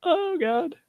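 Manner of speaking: tiny high-pitched "oh no" reaction; mock dread. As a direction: squeak it out in mock alarm. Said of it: "I'm like".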